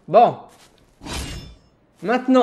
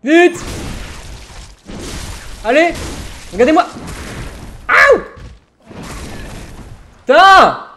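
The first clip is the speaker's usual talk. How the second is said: frantic yelling; 8 semitones higher, slower than usual. Real, not acted. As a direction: yell in a frantic rush. Frantic fight, yelling at himself.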